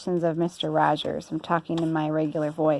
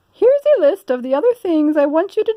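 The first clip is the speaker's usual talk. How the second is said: high, louder fussy lady voice; Missus Rogers; 10 semitones higher, 7 dB louder than usual. Acted. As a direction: bustle like a busy mistress. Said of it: "said Missus Rogers".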